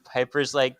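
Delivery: ordinary delivery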